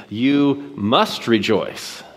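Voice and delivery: "must" leaned on, slow and firm; stress on must. Shown as a demonstration. Pastor to congregation, "must" pressed slowly as a command. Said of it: "The verbal tense is a command".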